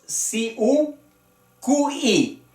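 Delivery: each piece of "si, u, cu, i" on its own; vowels pulled apart. Shown as a demonstration. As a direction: sound out "si, u" then "cu, i". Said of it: "Los diptongos".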